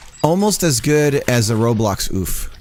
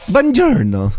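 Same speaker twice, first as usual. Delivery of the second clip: much higher, louder hammed-up Buongiorno; Italian accent; 10 semitones higher, 7 dB louder, slower than usual. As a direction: greet with a mock Italian flourish. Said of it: "I just need an Italian accent".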